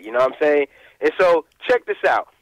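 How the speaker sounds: ordinary talk, nothing marked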